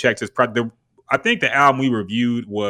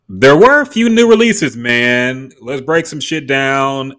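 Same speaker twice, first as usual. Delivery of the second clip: hyped announcement; 4 semitones higher, 10 dB louder, slower than usual. Real, not acted. Loud hype into new segment.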